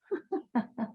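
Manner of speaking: short, soft laugh. Real, not acted. Artist laughing after admitting she doesn't know, soft chuckle.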